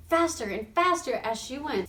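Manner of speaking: plain reading voice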